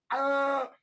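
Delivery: short rally whoop; excited shout. Retold or imitated. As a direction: whoop like a fired-up marine. Cheer after quoted command.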